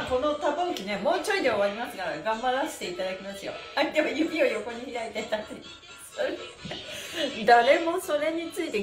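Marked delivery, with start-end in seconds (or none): none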